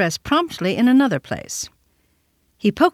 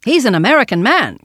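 put-on loud, raised boy's cry; hard little voice; 5 semitones higher, 6 dB louder than usual. A narrator's reading. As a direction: shout it out like a small boy. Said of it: "cried Randolph in his hard little voice".

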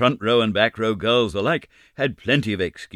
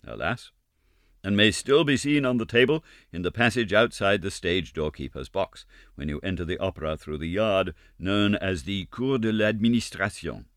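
usual speaking voice

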